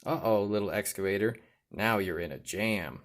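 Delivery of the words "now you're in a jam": usual speaking voice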